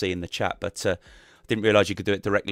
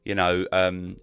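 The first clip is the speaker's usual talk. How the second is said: ordinary delivery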